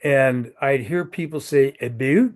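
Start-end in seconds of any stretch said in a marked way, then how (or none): 1.8-2.3 About? as Canadian aboot, rising; light Canadian accent on About?. Retold or imitated. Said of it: "my Canadian accent".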